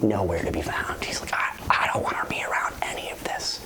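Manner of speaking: barely-there whisper, shrinking from company; shy withdrawn whisper. Retold or imitated. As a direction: imitate someone who wants to disappear. Voices Tosin shying away backstage.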